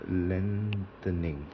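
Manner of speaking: lengthening drawn out slowly and softly; slow careful word. Shown as a demonstration. Teacher sounding out lengthening for the class, slow and soft. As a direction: stretch lengthening out syllable by syllable, quietly. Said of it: "L E N G H".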